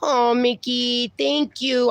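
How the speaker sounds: ordinary delivery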